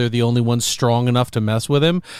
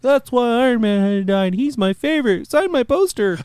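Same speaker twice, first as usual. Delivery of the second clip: high-pitched, gushing fanboy parody; mock fan voice; 10 semitones higher than usual. Mimicking a fan defending Iron Man's survival, mock fan voice. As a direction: climb higher and higher like a starstruck fan. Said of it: "somebody would wanna bring that theory because they're just like".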